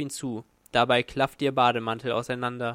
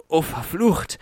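higher, louder, a gasped oath from the crowd; lightly voiced man gasping in lust; man gasping a curse; 4 semitones higher, 5 dB louder than usual. A narrator's reading. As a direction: blurt it out breathless with shock. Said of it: "keuchte ein Mann".

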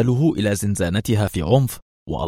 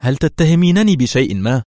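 raised, louder indignant question; sharp anger; 5 semitones higher, 5 dB louder than usual. A narrator's reading. Inspector confronts his prisoner over her insinuation, sharply angry. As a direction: challenge sharply, pitch and volume up. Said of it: "ويعتدل قائلًا في حدة".

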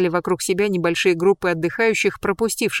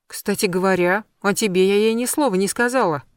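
ordinary delivery

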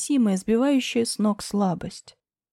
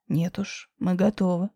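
low male voice; 4 semitones lower than usual. Acted. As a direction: answer low and sure. Grisha low.

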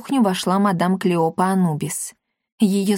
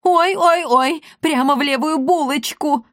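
high, hammed-up yowls; moaning in pain; 7 semitones higher than usual. A narrator's reading. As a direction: wail like a cat stuck with a needle. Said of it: "застонал Акаций".

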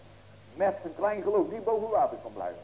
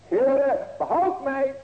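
high, loud plea for rescue; crying out; 10 semitones higher, 8 dB louder, much slower than usual. Acted. Preacher voicing the needy heart crying to God, loud plea. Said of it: "hoor maar".

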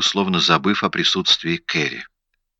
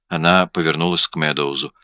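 ordinary delivery

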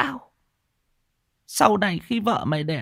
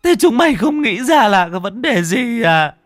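high wailing mother-in-law, growing louder; tearful, snivelling; 11 semitones higher, 5 dB louder than usual. A narrator's reading. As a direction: start in a high whimper and swell into loud, anguished reproach. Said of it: "bấy giờ mới sụt sịt mếu máo". (mếu máo sụt sịt, giọng cao dần to lên)

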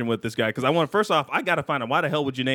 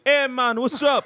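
higher mock Jamaican accent; 8 semitones higher, slower than usual. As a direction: play a Jamaican rapper in a Jamaican accent. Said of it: "He's like".

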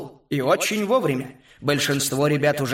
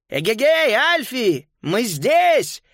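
high, hallooing call; full-throated shout; 8 semitones higher, 4 dB louder, slower than usual. A narrator's reading. Boy lures the bulldog down the corridor, shouting at full throat. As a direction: call out loud to taunt from a distance. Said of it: "во всё горло крикнул он".

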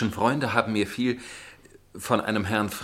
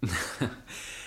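a quiet nervous breath of a laugh; faint uneasy chuckle; soft awkward laugh; 9 dB quieter than usual. Acted. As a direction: laugh it off, embarrassed. Embarrassed at her curt reply.

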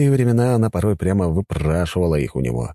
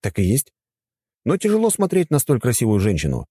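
put-on lightly higher, courteous young man; polite young man voice; 6 semitones higher than usual. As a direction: speak as a respectful young god. Gauss compliments the goddess. (вежливый молодой мужчина)

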